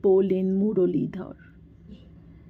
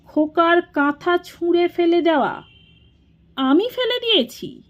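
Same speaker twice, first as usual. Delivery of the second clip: much higher, incredulous echo of the accusation; shocked disbelief; 9 semitones higher than usual. A narrator's reading. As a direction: question it in stunned, high-pitched protest. Elder brother shocked at the accusation. (অবিশ্বাসে চড়া গলার প্রতিবাদ)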